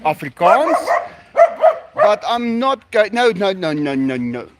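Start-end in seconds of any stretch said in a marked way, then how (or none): none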